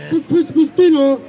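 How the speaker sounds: ordinary talk, nothing marked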